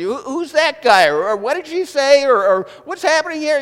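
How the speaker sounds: high, loud, puzzled questions; mock clueless whisperer in the theater; clueless movie talker. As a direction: whine like a confused moviegoer. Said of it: "they're asking constantly throughout the movie".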